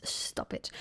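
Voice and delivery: whispered stop it to herself; whispered self-scold. Real, not acted. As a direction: hush yourself with a whisper.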